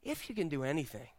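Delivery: low, hushed desperation; a desperate father's quiet begging; desperate plea. Acted. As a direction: plead softly, sinking in pitch. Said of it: "You hear the desperation in his voice?".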